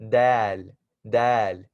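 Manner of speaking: light smiling dal, spoken clearly twice. Shown as a demonstration. Teacher reviewing the smiling letter dal for beginners. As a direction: say dal with a spread smiling mouth. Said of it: "we know that we're smiling".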